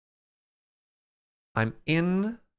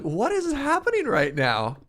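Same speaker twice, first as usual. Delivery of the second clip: high-pitched mock bewilderment; incredulous, pitched way up; bewildered; 12 semitones higher, 4 dB louder than usual. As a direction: squeal it in disbelief. Acts out bewildered reaction.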